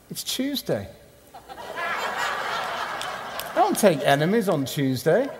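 preacher teasing his heckler, laughing; laughing tease. Retold or imitated. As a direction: deliver the joke chuckling.

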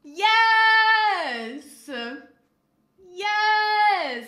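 long, high, very loud cheers; triumphant yelling. Real, not acted. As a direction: belt out drawn-out shouts of victory. Celebrating a correct guess.

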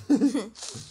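burst of laughter. Real, not acted. Finds loot.

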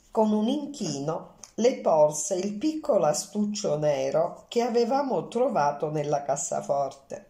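ordinary delivery

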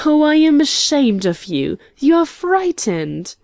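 high-pitched scolding, lightly acted; mock reproach. A narrator's reading. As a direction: scold a friend for his cold feet. Woman teasingly scolds her hesitant friend.

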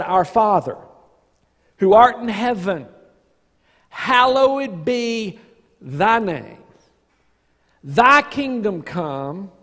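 slow, solemn recitation of the Lord's Prayer; recited prayer. Acted. Pastor reciting the Lord's Prayer to the congregation, solemn and slow. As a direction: recite each line slowly and reverently.

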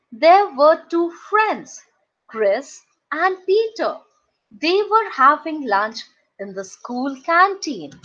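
usual speaking voice

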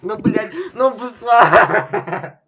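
high, loud, slow laughing yell; frustrated yell. Real, not acted. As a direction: yell at the game, half laughing. Frustrated at his failing game.

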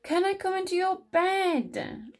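high, insistent mouse plea; pleading Little Mouse. A narrator's reading. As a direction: beg high, stretching the last word. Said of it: "asked Little Mouse".

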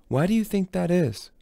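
high, loaded rhetorical question; pointed question. Acted. Rowan taunting Brahms, high and pointed.

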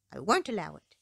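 a hint of a stubborn king; mildly stubborn refusal. King refusing his daughter, mildly stubborn. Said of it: "he said stubbornly".

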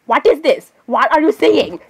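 ordinary talk, nothing marked

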